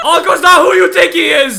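high, loud comic revelation; mock-dramatic shout. Player revealing uncle's secret to friend, mock-dramatic shout. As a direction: yell out the family secret. Repeats family secret as yell.

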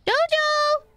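high call across the room; calling the cat. Real, not acted. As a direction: sing out the cat's name to fetch him.

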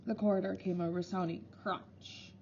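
usual speaking voice

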